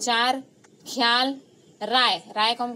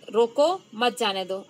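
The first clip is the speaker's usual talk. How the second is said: "रोको" raised and set apart, then "मत जाने दो" falls; pause after रोको. Shown as a demonstration. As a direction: lift रोको, break, drop on "मत जाने दो". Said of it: "के बाद कॉमा लगा दिया".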